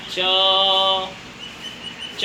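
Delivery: च said alone twice, tongue on the palate, with a pause between; isolated palatal sound. Shown as a demonstration. Teacher showing students where च is made, repeated palatal sound. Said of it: "आप बोल के देखिए".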